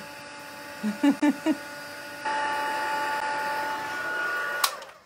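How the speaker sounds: high chuckle in quick bursts; delighted laugh. Real, not acted. Crafter pleased by a viewer's comment, delighted laugh. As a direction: chuckle at a compliment.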